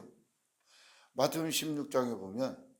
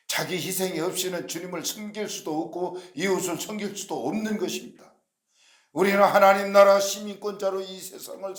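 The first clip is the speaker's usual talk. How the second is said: raised, declamatory sermon voice; forceful preaching; 4 semitones higher, 7 dB louder than usual. Real, not acted. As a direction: proclaim it with loud surges on key phrases. (힘주어 선포하는 설교조)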